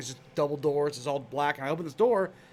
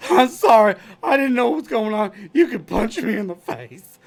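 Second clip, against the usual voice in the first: high, much louder, frantic apology; apologetic Joe; 5 semitones higher, 8 dB louder than usual. Retold or imitated. Joe apologizing loudly to Patrick in the hallway. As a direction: grovel at full volume. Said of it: "And Joe's like".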